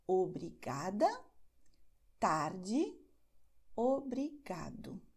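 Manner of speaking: GA in "obrigada" and "obrigado", TAR in "tarde" drawn out; penultimate stress. Shown as a demonstration. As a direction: say "obrigada, tarde, obrigado" slowly, leaning on the next-to-last syllable. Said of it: "nós acentuamos a penúltima sílaba". ("obrigada, tarde, obrigado" com a penúltima sílaba destacada)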